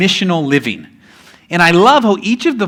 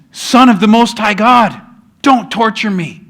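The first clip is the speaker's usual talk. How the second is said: loud, high, desperate begging; desperate plea; 5 semitones higher, 4 dB louder than usual. Retold or imitated. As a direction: cry out for mercy, louder and higher. Demoniac begging at Jesus' feet.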